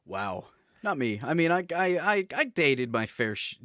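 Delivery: usual speaking voice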